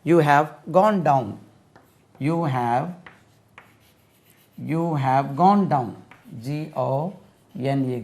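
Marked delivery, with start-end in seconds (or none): 0.1-5.9 slow, clear model sentence. Shown as a demonstration. Teacher modelling slowly for students to repeat. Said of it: "model sentence".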